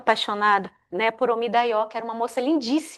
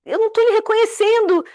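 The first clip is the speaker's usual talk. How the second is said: dismayed wife; 9 semitones higher, 4 dB louder than usual. Retold or imitated. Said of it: "E aí ela fala".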